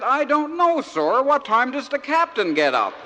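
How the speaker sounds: high, loud innocent protest; naive loud answer. Acted. George answering the officer's threat, naive and loud. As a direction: belt out the punchline in earnest innocence.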